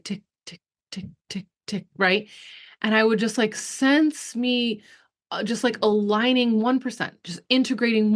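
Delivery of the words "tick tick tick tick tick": soft clicking tick sounds; mock stereo dial ticking; dial clicks. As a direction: quietly imitate a dial clicking. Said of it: "it would be like".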